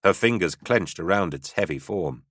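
usual speaking voice

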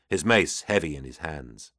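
usual speaking voice

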